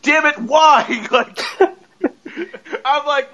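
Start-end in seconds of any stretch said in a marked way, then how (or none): 0.0-0.8 loud, high mock outburst; getting mad. Imitating a coworker who wants an explanation, mad outburst. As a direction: explode like a nosy coworker denied answers. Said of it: "they start getting mad".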